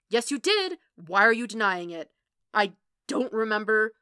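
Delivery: loud, angry back-and-forth; heated quarrel. Acted. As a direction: raise the voice and argue hard. Two men in escalating quarrel.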